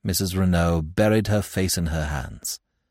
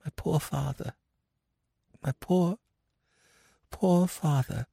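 soft, tearful and higher; 7 semitones higher, 8 dB quieter than usual. Acted. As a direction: hold back tears. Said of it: "tears came into his eyes".

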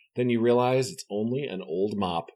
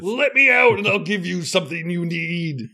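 growling monster; 6 semitones higher, 3 dB louder than usual. Acted. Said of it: "Spy Eye's growling voice".

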